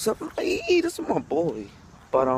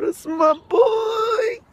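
high, long-drawn whooping shout; shouted catchphrase; 4 dB louder, much slower than usual. Pretend. Vlogger hyping his catchphrase before plugging merch, drawn-out shout. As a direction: holler it way up high and stretch the last word.

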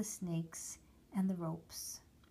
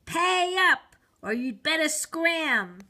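loud, much higher, threatening yell, slowing at the end; threatening shout; 7 semitones higher, 13 dB louder, slower than usual. A narrator's reading. Conjurer shouting threats at the boy who wants the dish. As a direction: roar it at the boy like a bully. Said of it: "he shouted out threateningly".

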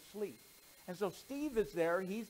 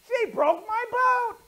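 put-on high, loud, slow childish taunt; sing-song it like a spiteful little brother; gloating boy; 14 dB louder, slower than usual. Steve gloats again.

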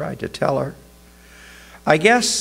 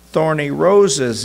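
plain reading voice

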